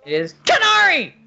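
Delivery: high, loud shouted name; all caps shout. Shown as a demonstration. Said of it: "it's all in caps".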